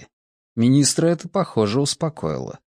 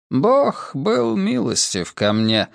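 slow, higher-pitched humble answer; a touch of humility, unhurried; modest reply; 6 semitones higher, slower than usual. A narrator's reading. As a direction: answer modestly, drawing the words out. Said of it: "скромно ответил тот".

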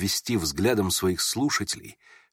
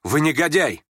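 raised, forceful accusation; angry insult; 5 semitones higher, 4 dB louder than usual. A narrator's reading. Offended youth staring down Ganya, angrily insulting him. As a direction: throw the insult straight at him.

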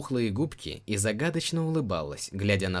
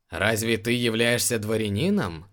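ordinary delivery

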